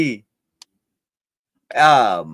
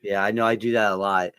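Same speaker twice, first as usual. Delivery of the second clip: usual speaking voice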